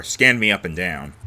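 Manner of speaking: plain reading voice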